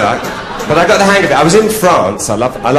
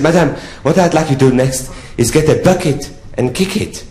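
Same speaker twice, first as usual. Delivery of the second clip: higher-pitched French count; French accent; 5 semitones higher than usual. Acted. French count telling the prostitute what next, French accent. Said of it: "he said to me in a French accent".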